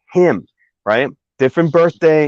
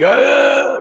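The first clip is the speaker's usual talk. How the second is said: high raspy impression of a sheriff; scratchy voice; 3 dB louder than usual. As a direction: rasp it out like a hoarse old cop. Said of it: "one of the sheriffs with a scratchy voice".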